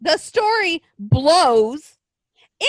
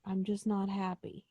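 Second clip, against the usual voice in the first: ordinary delivery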